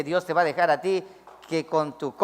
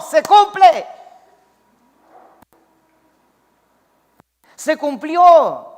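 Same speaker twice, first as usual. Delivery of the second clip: high, loud preacher cry; full-force sermon peak; preaching shout; 9 semitones higher, 11 dB louder, much slower than usual. Real, not acted. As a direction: slowly hammer home the second phrase. Preacher peaks on fulfilled prophecy.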